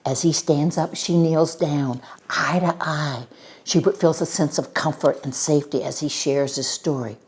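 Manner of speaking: usual speaking voice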